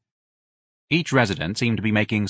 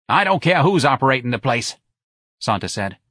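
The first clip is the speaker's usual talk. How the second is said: louder, higher dismissive retort; brash Santa; 5 semitones higher, 4 dB louder than usual. Acted. Santa dismisses who runs the hospital, brash. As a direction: brush the objection aside.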